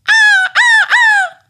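very high, shrill-voiced bird screech; a seagull screeching to warn the girl; seagull's cry. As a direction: call out like a squawking gull. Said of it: "L'oiseau crie".

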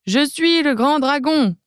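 mock roar. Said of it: "tu cries".

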